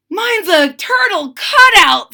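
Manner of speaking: high, loud little snail. Acted. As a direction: squeak it out proudly. Snail voiced.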